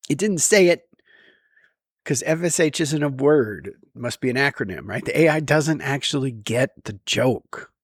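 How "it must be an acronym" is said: usual speaking voice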